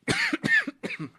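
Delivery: sharp cough from a drink gone down wrong; choking cough. Real, not acted. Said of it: "I'm choking on my drink".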